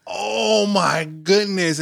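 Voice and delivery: high, drawn-out "oh my goodness"; dismayed. Retold or imitated. Caller learning the wife was upset, in drawn-out dismay. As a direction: stretch it out in retold dismay. Retells her shock at the cancellation.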